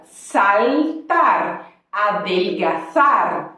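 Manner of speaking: saltar and adelgazar said slowly with a clear L; clear Spanish L. Shown as a demonstration. Teacher models the Spanish L slowly for students. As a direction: press the tongue up for the L in saltar, adelgazar. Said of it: "a língua tem que vir para o céu da boca".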